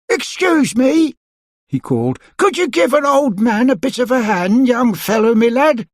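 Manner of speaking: put-on high, creaky old man call. A narrator's reading. Old man calling to Mister Stingy, asking help. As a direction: call over the garden wall.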